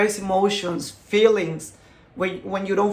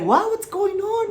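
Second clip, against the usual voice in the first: much higher, alarmed mom asking; startled mom; 12 semitones higher than usual. Retold or imitated. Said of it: "She was like".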